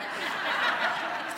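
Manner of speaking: breathy little laugh; soft chuckle. Real, not acted. Pastor chuckling at his own hint to the congregation.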